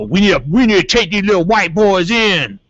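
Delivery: high, loud put-on cop voice; imitated cop. Cop wanting to haul boys in, loud imitation. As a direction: play up the indignant officer.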